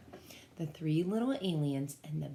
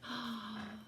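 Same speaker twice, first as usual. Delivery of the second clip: soft gasp; 4 semitones higher, 11 dB quieter than usual. Real, not acted. Sees Saturn.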